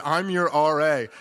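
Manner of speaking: laughing punchline. Retold or imitated.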